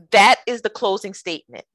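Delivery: ordinary talk, nothing marked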